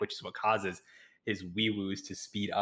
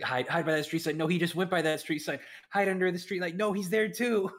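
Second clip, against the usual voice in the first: rising, higher frantic calls; frantic voice climbing higher; panicked directions; 10 semitones higher, 4 dB louder than usual. Retold or imitated. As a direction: blurt hiding spots in growing panic. Frantic scared Patrick calls.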